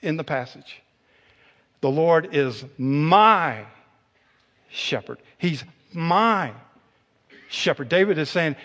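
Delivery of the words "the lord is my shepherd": slow "The Lord is my", weight on "my"; stress on my. Shown as a demonstration. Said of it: "the emphasis".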